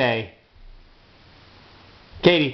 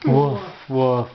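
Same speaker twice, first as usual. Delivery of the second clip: lower, playful woof woof; dog bark imitation; 5 semitones lower than usual. Shown as a demonstration. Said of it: "What's a doggy say?".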